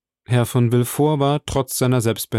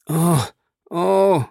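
high-pitched, dazed moan; stunned murmur; 6 semitones higher than usual. A narrator's reading. Bertuccio finally finds voice on seeing Villefort, stunned. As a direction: let out stunned little ohs. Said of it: "murmelte er endlich".

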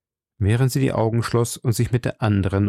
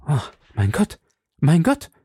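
anguished exclamation; 7 semitones higher than usual. A narrator's reading. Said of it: "rief Anna von Österreich".

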